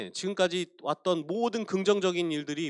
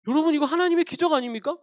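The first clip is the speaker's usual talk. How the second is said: ordinary talk, nothing marked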